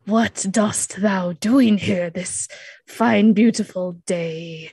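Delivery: slow, loud mock-archaic proclamation; pompous hero hailing strangers; self-appointed leader. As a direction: declaim it grandly, stretching each word. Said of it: "presents himself as the leader of the group".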